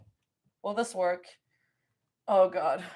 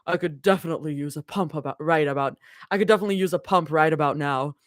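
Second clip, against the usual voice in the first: Abby voice; 6 semitones lower, faster than usual. Acted. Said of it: "I need to go even lower".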